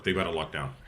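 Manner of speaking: ordinary delivery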